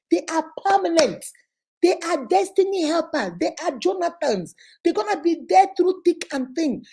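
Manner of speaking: fervent exhortation; rising sermon. Acted. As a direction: preach it to the congregation. Preaching about true friends.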